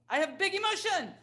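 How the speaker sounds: high, quick mock cry; child's big emotion. Acting out a child calling for special time, high urgent cry. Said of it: "They can call for special time".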